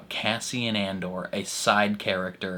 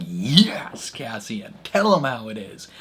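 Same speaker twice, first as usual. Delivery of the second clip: cheering on; 5 dB louder than usual. Retold or imitated. Cheering Cassian on, mock voice.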